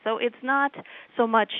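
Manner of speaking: ordinary talk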